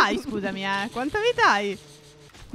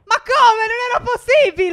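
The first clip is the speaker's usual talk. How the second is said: high, louder cry of disbelief; real indignant disbelief; 9 semitones higher, 4 dB louder than usual. Real, not acted. Player protesting the impossible hit to the opponent, indignant disbelief. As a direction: protest loudly, pitch shooting up.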